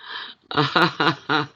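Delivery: short run of leftover chuckles; real laughter. Real, not acted. Still amused by the mix-up.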